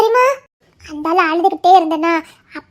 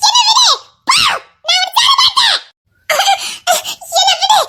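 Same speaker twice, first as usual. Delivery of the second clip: angry shouting; 13 semitones higher than usual. Acted. Daughter refuses angry father.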